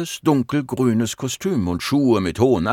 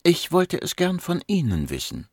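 calmly insist, with great patience, in a raised voice; patient; 8 semitones higher than usual. A narrator's reading. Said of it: "Sie hatte große Geduld". (geduldig und unerschütterlich)

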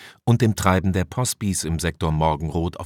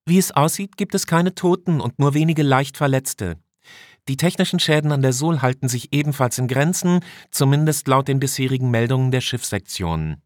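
much higher female officer voice; female officer reporting; 7 semitones higher than usual. Acted. Officer Tanaka reports casualties and damage, high-voiced. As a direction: deliver a status report, pitched high.

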